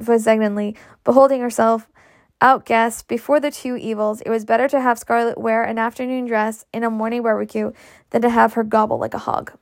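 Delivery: ordinary delivery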